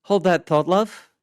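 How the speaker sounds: high loud tipsy woman's voice; brushing her off; Adams voiced. A narrator's reading.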